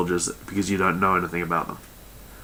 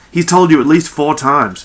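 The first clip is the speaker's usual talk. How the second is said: raised, louder, slowed-down exasperation; almost shouting; 6 semitones higher, 7 dB louder than usual. A narrator's reading. Pendercrest correcting a forgetful crewmate, almost shouting. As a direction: push it just short of a shout. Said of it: "Pendercrest almost shouted".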